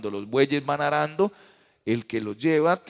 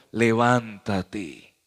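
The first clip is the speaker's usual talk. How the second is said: very slow, louder commanding call; drawn-out command; 4 semitones lower, 4 dB louder, much slower than usual. Acted. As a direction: stretch the word out like a divine order. God's command to Paul. (orden divina muy lenta y fuerte)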